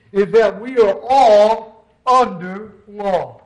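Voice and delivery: slow, weighty stress on "all", "under", "law"; emphatic, spaced words. Real, not acted. Preacher stating first point to brethren, "all under law" spaced emphatically. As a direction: set "all", "under" and "law" apart with pauses.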